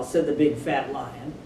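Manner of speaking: ordinary delivery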